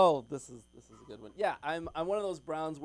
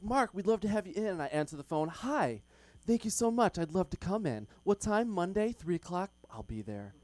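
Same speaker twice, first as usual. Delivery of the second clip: mock eager phone voice; 5 semitones higher, 5 dB louder than usual. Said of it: "I'm like".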